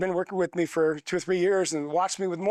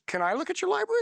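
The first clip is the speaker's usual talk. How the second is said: voicing the friend; 12 semitones higher, faster than usual.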